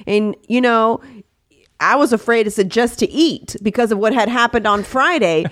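usual speaking voice

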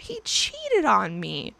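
real distress rising to a high squeak; upset outburst. Real, not acted. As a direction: voice jumps high, pitch straining with upset. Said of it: "No, I can't fucking work this shit out".

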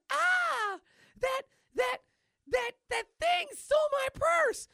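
very high, loud shriek; hammed-up scream of a robbed lady; shrieking woman. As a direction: stammer in alarm, then screech the accusation. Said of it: "I believe is a high-class hooker".

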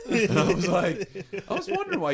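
laughing. Real, not acted. Laughing while telling.

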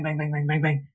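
ordinary talk

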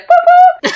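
very high, loud boop boop; referee whistle imitation. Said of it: "the referee would come in".

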